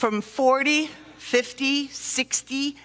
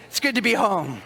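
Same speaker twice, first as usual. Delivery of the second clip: relieved sigh; 5 semitones lower than usual. Retold or imitated. Relief arriving home.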